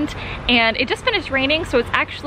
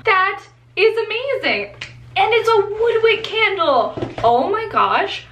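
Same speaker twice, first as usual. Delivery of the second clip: excited gushing; 8 semitones higher than usual. Real, not acted. Unboxing a surprise package.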